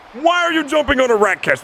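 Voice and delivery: high, loud frustrated yell at the player; exasperated shout. Real, not acted. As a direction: yell in disbelief at a dumb play. Yelling at a player's mistake.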